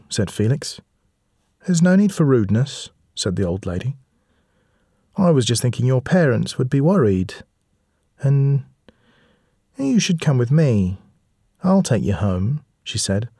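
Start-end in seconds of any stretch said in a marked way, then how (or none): 1.7-2.9 put-on old lady voice. 5.2-12.6 put-on old lady voice. Old lady luring children.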